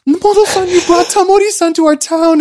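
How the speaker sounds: high, excited fan thanks; high gushing townsperson thanking the host. Retold or imitated. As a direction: play a thrilled local greeting the host.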